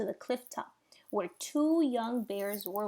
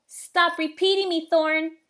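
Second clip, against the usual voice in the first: annoyed; 6 semitones higher, 11 dB louder than usual. A narrator's reading. Sister snaps at her brother.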